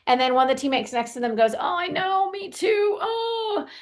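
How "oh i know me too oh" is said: high whiny moaning agreement; a teammate joins in with a high, whiny mock moan. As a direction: whine along like a sore teammate. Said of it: "they all start moaning".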